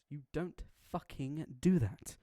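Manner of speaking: loud, slow, emphatic telling-off; acted furious reprimand; angry scolding.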